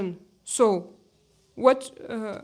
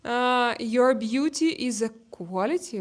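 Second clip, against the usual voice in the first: usual speaking voice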